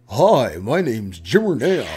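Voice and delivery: slow, drawn-out corny introduction; Jimmer voice attempt. Shown as a demonstration. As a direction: stretch out a badly faked goofy voice. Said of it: "like, let me try".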